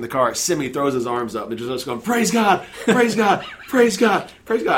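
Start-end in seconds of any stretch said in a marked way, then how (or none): 2.0-2.7 high, loud jubilant shout; exultant cry. Retold or imitated. Caseworker celebrating in the car, exultant. Caseworker rejoices, arms thrown up. 2.8-4.8 high, loud jubilant shout; exultant cry. Retold or imitated. Caseworker celebrating in the car, exultant.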